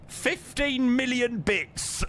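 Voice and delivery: milk the ridiculous figure playfully; mock-auction slow announcement; mock grand demand. Joking price demand to viewer.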